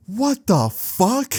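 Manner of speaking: high, slow, stretched-out mock shock; shocked mom. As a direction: imitate mom gasping out disbelief. Mom discovers Henry in closet.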